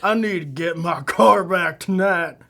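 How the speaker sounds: put-on loud, slow thick Southern accent; menacing thick Southern accent drawl. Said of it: "a thick Southern accent".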